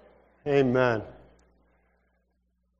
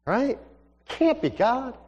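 high, rising "Can't be God"; wavering doubt; 9 semitones higher, slower than usual. Acted. Young man questions whether God is speaking, high rising doubt.

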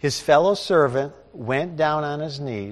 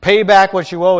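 louder, sharp demand for the money; 5 semitones higher, 10 dB louder than usual. Acted. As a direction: bark the order at the debtor. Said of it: "he demanded".

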